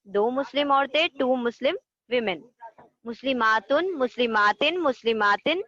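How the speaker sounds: sing-song drill chant, rising then falling on each set of three; rhythmic table recitation. Shown as a demonstration. Teacher leading students in chanting the table, in a rhythmic sing-song. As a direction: chant the grammar table for the class to repeat.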